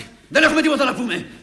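loud, high-pitched outburst; angry refusal. Acted. Son refusing to talk with his father, angry refusal.

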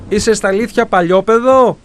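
calling out. A narrator's reading. The man calls out after the boy running away, loud. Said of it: "άκουσε τον άντρα να του φωνάζει".

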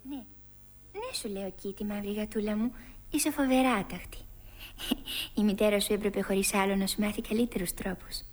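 soft, mock-stern telling-off; gentle scolding. Alice to her kitten, soft mock scolding.

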